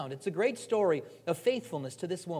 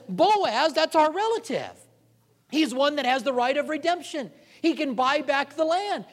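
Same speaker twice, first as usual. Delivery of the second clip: excited Naomi; 7 semitones higher than usual. Retold or imitated. Naomi excited about relative Boaz.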